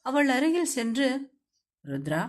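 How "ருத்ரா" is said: low male voice calling softly; low male character voice calling Rudra; calling her name. A narrator's reading. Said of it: "என அழைத்தான்".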